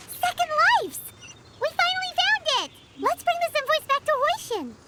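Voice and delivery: high, bright burst of joy; you've finally found it, cheer; excited discovery. Acted. Finds the invoice they searched for.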